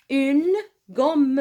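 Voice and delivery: une gomme with the mm held long and hit hard; stress on gomme. Shown as a demonstration. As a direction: lean on the double m in gomme. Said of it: "لماذا أشدد على حرف الأم؟". (تشديد على حرف الميم في gomme)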